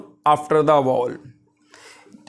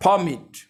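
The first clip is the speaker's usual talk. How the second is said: vomit as a noun, first syllable prominent, then falling; first-syllable stress; 3 semitones higher than usual. Shown as a demonstration. Teacher repeating the noun form, vomit stressed on its first syllable. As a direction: stress the start of vomit and let it fall. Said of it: "तो ये noun कहलाता है".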